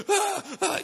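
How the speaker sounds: high imitated panicked gasps; panic crying. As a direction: mimic gasping, panicky sobs. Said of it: "started to do the panic crying".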